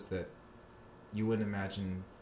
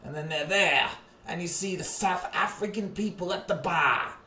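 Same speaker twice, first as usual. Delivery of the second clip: mock Aussie cheer; 11 semitones higher, 14 dB louder than usual. Mock Aussie bar shout.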